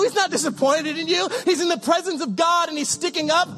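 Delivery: ordinary talk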